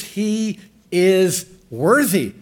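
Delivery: slow, weighty "worthy" held long; slow, stressed worthy. Shown as a demonstration. Preacher to congregation, slow stress on "worthy". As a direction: lean on "worthy" and draw it out. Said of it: "Catch those words".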